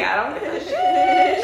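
high squeaky mock reply. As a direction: squeak it out, laughing. Mock carefree quote, very high.

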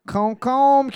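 concombre said in French; French word. Shown as a demonstration. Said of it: "they would say cucumber like".

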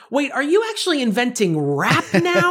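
high, amazed mock line; imitating a stunned fan, hammed up; mock incredulous singer. To co-host, mocking his talk singing, high and incredulous.

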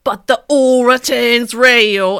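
loud, slow, hammed-up English accent; high, drawn-out theatrical British accent; put-on English accent. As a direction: channel an exaggerated English accent, dropping the H. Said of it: "Let me channel it back".

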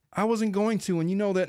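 lightly voiced high, meek protest; a hint of timid sheepishness; sheepish Michael voice. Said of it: "very sheepish Michael voice".

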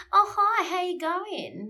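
put-on bright Australian accent, high and loud on "Oh, hi"; Australian greeting. Imitating how Australians greet people, high Australian accent. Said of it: "so I'll probably say".

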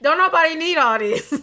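loud, high, laughing complaint; laughing exasperation. Real, not acted. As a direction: laugh it off, fed up.

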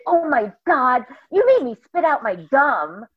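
high, loud mock gasp of shock. Retelling the girl from court recognizing her, high loud surprise. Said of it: "And she gasped and she said".

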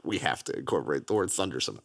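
low, soft, fast mock exec mutter; mock studio exec. Mocking studio execs forcing the word thunder in. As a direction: rattle it off low like a scheming exec. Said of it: "They were like".

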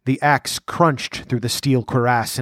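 usual speaking voice

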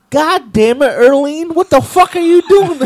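loud, high exasperated yell; acted-out furious outburst, rising in pitch; angry uncle. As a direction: bellow it in disbelief at a relative. Uncle yells at fallen grandma.

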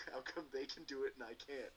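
high, put-upon complaint; indignant demand to know why; frustrated complaint. Retold or imitated. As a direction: sulk like the player who keeps failing. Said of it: "officers get very frustrated".

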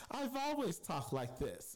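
high-pitched mock proper voice; hammed-up prim and proper reply. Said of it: "She talks very proper".

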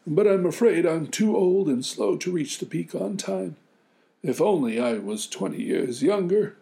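put-on high old man voice, worried; a fretful old grandfather. Old man doubts himself.

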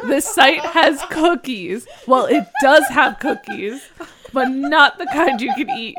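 loud, high, through laughter; laughing loudly. Real, not acted. Cohost laughing over a cookie pun, loud laughing voice. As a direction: crack up while talking.

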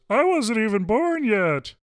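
high, slow froggy puppet voice; Kermit the Frog voice. Retold or imitated. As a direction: squeeze it up into a Kermit voice. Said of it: "I miss Kermit the Frog voice".

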